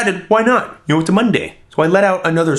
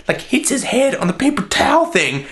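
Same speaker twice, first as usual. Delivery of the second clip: usual speaking voice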